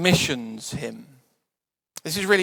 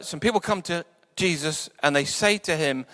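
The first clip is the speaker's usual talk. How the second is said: ordinary talk, nothing marked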